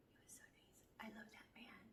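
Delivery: breathy unvoiced whisper, slowing at the end. Real, not acted. Whispering to viewers about her kind buddy. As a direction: confide it in a hushed whisper.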